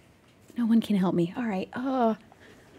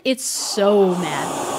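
repeating the line in a spooky low voice, slow and low; slow, low put-on voice; 6 semitones lower, much slower than usual. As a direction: drag it out in a low strange voice.

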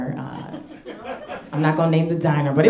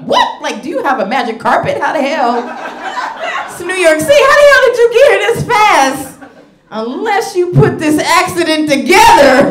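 high, loud, incredulous mock disbelief; can't believe how fast she arrived; amazed disbelief; 9 semitones higher, 5 dB louder than usual. Comic asking her date how she got there, high incredulous disbelief. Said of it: "And I was like".

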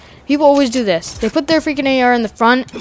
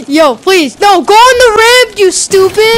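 frustrated gamer rage; 9 semitones higher, 7 dB louder than usual. Real, not acted. Raging at game opponent.

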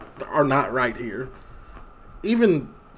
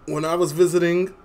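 ordinary talk, nothing marked

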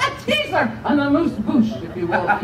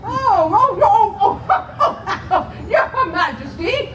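flustered laughing; 8 semitones higher, much slower than usual. Real, not acted. Mayor caught out, flustered.

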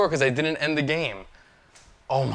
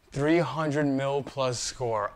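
ordinary delivery

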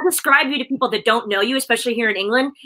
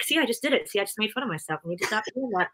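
softer, muttered self-scolding aside; quiet self-scolding; 9 dB quieter than usual. Retold or imitated. Telling bloggers her own habit, softer mock self-scolding. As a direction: scold yourself under your breath, softer. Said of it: "I always say, like".

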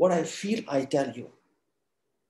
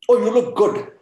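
bright, high, louder mock flattery; gushing compliment; 5 semitones higher, 5 dB louder than usual. Friend gushing over your looks, higher and louder. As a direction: pile on the praise like a friend.

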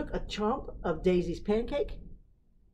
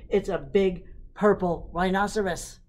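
shouted; 2 semitones higher, 8 dB louder than usual. Acted. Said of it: "Daisy shouted".

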